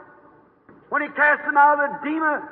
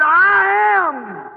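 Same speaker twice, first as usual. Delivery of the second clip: shouted proclamation; 5 semitones higher than usual. Acted. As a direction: cry it out to the lost souls. Jesus proclaims to lost souls.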